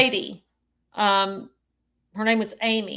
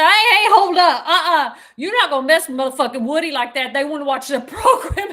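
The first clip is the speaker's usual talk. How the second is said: loud laughing defense; 3 semitones higher, 8 dB louder than usual. Retold or imitated. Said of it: "And she'd say".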